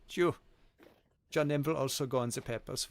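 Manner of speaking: mild mock German officer accent; cartoonish German accent. Streamer doing a chat-requested German accent. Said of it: "cartoonish German accent".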